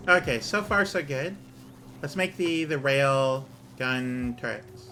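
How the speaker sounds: usual speaking voice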